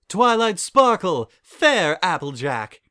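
loud, slow, grand greeting; commanding royal voice. Acted. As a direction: hail them like a princess. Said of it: "still sounding commanding".

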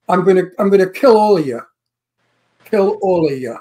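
New York tough line, "all of you" run together; fast, punchy New York threat; New York accent. Shown as a demonstration. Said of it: "good New York accent".

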